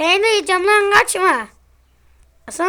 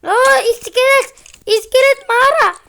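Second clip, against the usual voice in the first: excited alarm; 7 semitones higher, 7 dB louder than usual. Real, not acted. Spots skeleton in cave.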